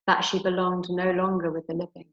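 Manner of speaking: usual speaking voice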